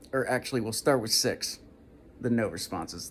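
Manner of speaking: ordinary talk, nothing marked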